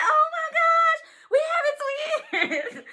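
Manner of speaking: high, loud squeal breaking into a laugh; re-enacted excited scream. Mother learning she has twins, re-enacted happy scream. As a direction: squeal it out, long and overjoyed. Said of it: "my husband heard me scream".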